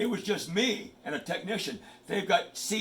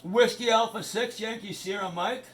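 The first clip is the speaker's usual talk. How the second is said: radio call sign; 5 semitones higher than usual. Shown as a demonstration. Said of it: "This is how it works".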